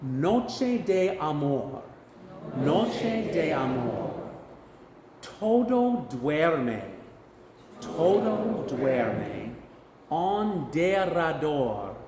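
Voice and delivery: Spanish phrases spoken slowly, syllable by syllable; slow Spanish pronunciation model. Shown as a demonstration. Choir director saying Spanish phrases to repeat, slow pronunciation model. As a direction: stretch each Spanish syllable for learners to copy. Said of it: "I'll say it first and then you can repeat after me".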